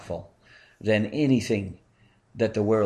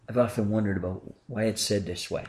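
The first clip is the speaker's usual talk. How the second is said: ordinary talk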